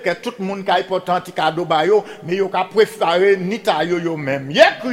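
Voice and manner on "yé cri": lancer « Yé krik » au public; cri d'appel sonore qui monte; appel de conteur. Pretend. (apèl kontè)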